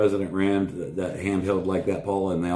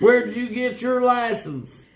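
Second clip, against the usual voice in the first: high, slow indignant shout; road rage yelling; 4 dB louder, slower than usual. Retold or imitated. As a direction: yell it at a bad driver.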